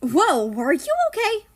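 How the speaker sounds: high, loud startled question; startled concern; surprised. Acted. Character startled by squeak.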